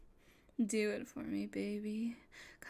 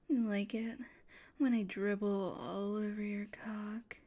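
ordinary talk, nothing marked

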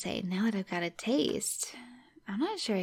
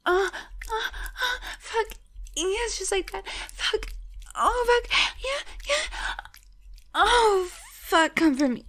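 much higher, loud ecstatic cries; climactic cries; 13 semitones higher, 6 dB louder, slower than usual. Acted. Lover reaching climax with her partner, high loud ecstatic cries.

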